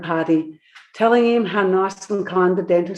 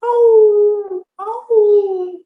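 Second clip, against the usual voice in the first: high pitiful howl; yowling dingo; 5 dB louder than usual. A narrator's reading. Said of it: "yowling piteously".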